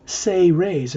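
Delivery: "Say Rays" as two distinct words, say then rays; shown pronunciation. Shown as a demonstration. Guide explaining the book title's pronunciation, "Say Rays" said as say-rays. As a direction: pronounce "Say Rays" rhyming with day days. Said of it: "is pronounced".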